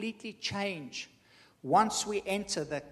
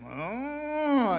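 long, louder, higher imitated "oh"; imitated background sound; 8 semitones higher, 4 dB louder than usual. Speaker mimics the sound behind the ad, drawn-out imitation. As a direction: draw out a loud sound that swells up and falls. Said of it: "in the background".

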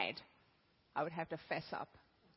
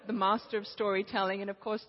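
ordinary talk, nothing marked

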